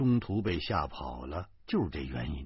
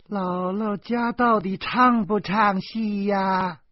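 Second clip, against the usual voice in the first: put-on high, slow little boy's voice; child's singsong reply; 10 semitones higher, 4 dB louder, slower than usual. A narrator's reading. Doudou answering from the room in a high, slow child's voice.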